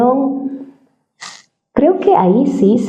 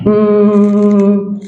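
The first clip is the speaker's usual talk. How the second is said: raised hum; 2 semitones higher than usual. Shown as a demonstration. Coach showing how to raise tone, raised hum. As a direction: lift the cow-like hum and hold it. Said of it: "intentar elevar o bajar".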